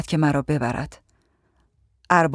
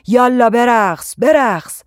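higher, louder, insistent order; shouted command; 6 semitones higher, 6 dB louder, slower than usual. A narrator's reading. Tyrant clapping as he makes her dance, shouting. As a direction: yell it out, the first words drawn out. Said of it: "همزمان فریاد کشیدن".

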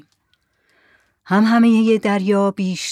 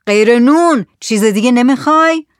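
raised, louder probing question; shouted question; 5 semitones higher, 7 dB louder than usual. Acted. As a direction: shout the question across to them. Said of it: "زکریا داد زد". (با صدای بلند داد زدن)

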